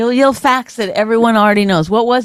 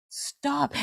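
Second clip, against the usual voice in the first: hushed stop; 4 semitones higher, 14 dB quieter than usual. Retold or imitated. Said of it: "You're like".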